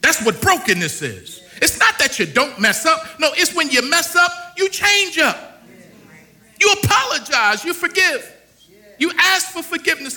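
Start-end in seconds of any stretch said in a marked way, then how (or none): 1.6-10.2 higher and louder, building fervor; preaching climax. Real, not acted. Preacher building fervor before the congregation. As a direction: lift it up and drive it home.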